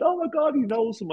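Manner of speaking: very high, excited squeal; high-pitched mock exclamation; mimicked crowd amazement. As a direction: imitate a whole cabin's astonishment. Imitating everyone's amazed outburst.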